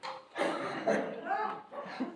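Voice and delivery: high airy burst of laughter; laughing. Real, not acted. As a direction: let a breathy laugh escape at the absurdity. Laugh after mother bolts.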